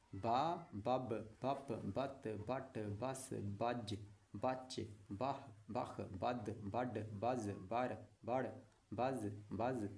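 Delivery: slow, even recitation of each sound; teacher chanting the alphabet sounds; syllable drill. Shown as a demonstration. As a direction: sound out every syllable clearly for pupils. Teacher drills Urdu syllables aloud.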